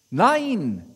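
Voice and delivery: loud call across the water; calling back. Acted. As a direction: shout the answer to the shore. (laut zurückgerufen)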